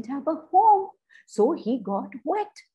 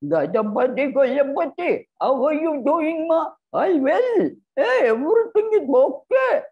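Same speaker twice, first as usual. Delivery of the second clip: put-on higher, caring old woman's voice; fussing old lady; 4 semitones higher, 4 dB louder than usual. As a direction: fuss over him like a kind old granny. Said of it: "and say".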